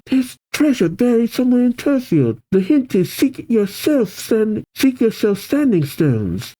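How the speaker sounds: put-on high-pitched child's voice; small, high girlish voice; little girl. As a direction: pass on a secret like a little girl. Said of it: "a little girl told me".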